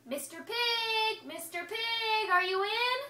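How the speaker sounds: high, slow, drawn-out holler. Acted. Wolf hollers at the brick house door, drawn out. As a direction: call out long to the brick house. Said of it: "So I hollered".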